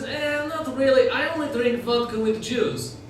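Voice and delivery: high, slow Ukrainian-accented impression; thick mock Ukrainian accent; strong Ukrainian accent. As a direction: mimic a Ukrainian woman, stretching the words. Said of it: "She had a very strong accent".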